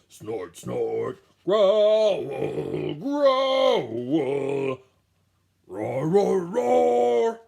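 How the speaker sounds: loud growling and roaring beast; loud beast noises; animal growls. Acted. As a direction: growl and roar like big animals. Animals making music.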